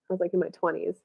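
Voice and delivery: ordinary talk, nothing marked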